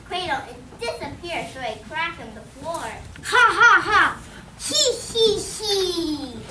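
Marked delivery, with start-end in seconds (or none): 3.2-6.4 loud, wicked mouse laugh; evil cackle. Acted. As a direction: cackle in triumph. The mouse cackles after cursing.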